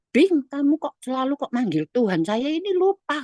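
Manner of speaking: high-pitched retold query; imitated puzzled husband. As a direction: voice the husband's question, pitch climbing.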